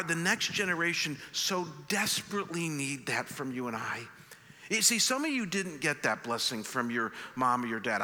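usual speaking voice

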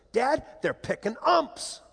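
put-on high, drawn-out child's announcement; boy breaking news. Son telling dad they're picking umps, imitated boyish astonishment. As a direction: imitate an amazed kid, stretching the words.

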